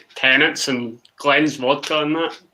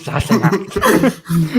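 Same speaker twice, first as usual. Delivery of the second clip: mock Sean Connery; 3 semitones higher, much slower than usual. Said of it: "Are you Sean Connery, man".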